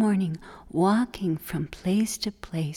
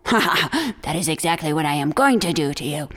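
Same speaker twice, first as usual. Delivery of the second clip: gloating coyote; 4 semitones lower, 3 dB louder, faster than usual. Acted.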